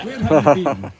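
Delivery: put-on Queen given a Hebridean accent; Outer Hebrides accent. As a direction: drop the royal accent for loud Hebridean. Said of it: "pretend the Queen's from the Outer Hebrides".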